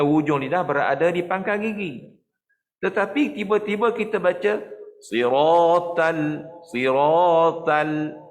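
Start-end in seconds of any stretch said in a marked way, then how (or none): 5.0-8.2 siratal with the ta sound thin, not heavy; demonstrating the mistake of a thin ta in siratal; wrong thin reading. Shown as a demonstration. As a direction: read siratal wrongly with a light ta. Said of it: "Huruf po tu kita pergi jadikan dia nipis".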